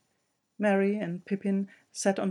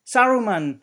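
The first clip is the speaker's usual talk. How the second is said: louder, commanding call of the name; loud commanding cry; 5 semitones higher, 13 dB louder than usual. Acted. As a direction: summon him with full authority. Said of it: "he cried in a loud, commanding voice".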